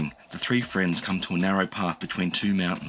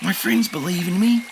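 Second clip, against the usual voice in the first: put-on high determined kid; young dinosaur; 10 semitones higher, 4 dB louder than usual. A narrator's reading. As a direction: steel yourself. Enki thinks.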